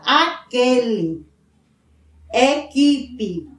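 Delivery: "aquele" slow as a-KE-le, no u sound; slow pronunciation demo. Shown as a demonstration. Teacher shows the silent u to pupils, slowly. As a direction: lay out "equipe" with a plain k, no w. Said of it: "O U não é pronunciado".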